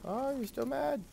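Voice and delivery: high cooing pet voice. Real, not acted. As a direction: coo at the sulking beetle like a pet. Coos at the angry pet.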